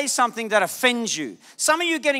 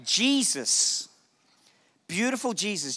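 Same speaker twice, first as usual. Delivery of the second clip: normal talking voice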